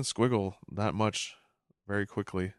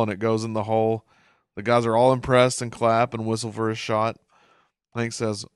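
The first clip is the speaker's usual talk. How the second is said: normal talking voice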